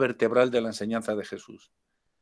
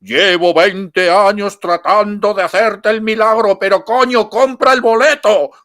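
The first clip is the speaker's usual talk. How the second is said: booming divine voice, much higher and louder, slow; thundering voice; 8 semitones higher, 10 dB louder, slower than usual. Retold or imitated. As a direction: boom it down from heaven like an exasperated God. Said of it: "se oye una voz así, ¡buah!, atronadora". (voz atronadora de Dios, lenta y muy fuerte)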